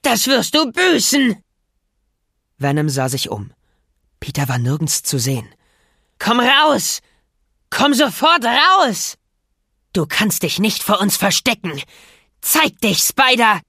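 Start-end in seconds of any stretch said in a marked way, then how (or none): 0.0-1.4 slow, high furious scream; furious threat. Acted. Venom threatening. 6.2-13.6 high, loud, raging shout; furious yelling. Acted. Venom hunting for Peter.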